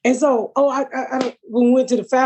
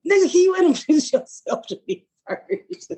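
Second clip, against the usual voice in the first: exasperated retort; 9 semitones higher than usual. Retold or imitated. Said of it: "I'm like".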